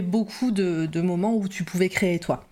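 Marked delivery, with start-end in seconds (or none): none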